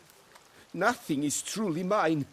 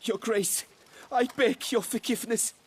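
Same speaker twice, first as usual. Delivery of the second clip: higher, begging plea for forgiveness; desperate plea; 6 semitones higher than usual. Acted. Servant begging the duchess for forgiveness, desperate. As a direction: grasp for mercy as you're sent away. Servant begs as he's dismissed.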